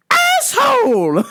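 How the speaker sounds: high, slow stretched yell of asshole; mom yelling. Retold or imitated. Mom yells at the driver she nearly hit, high drawn-out shout. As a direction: bellow it at the car.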